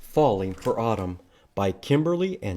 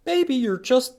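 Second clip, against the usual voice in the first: higher, brisk lightly voiced mom; mom suggesting; 9 semitones higher, 5 dB louder than usual. Mother coaxing Pete to love autumn, lightly voiced mom. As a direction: suggest it kindly as his mother. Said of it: "Mom suggests".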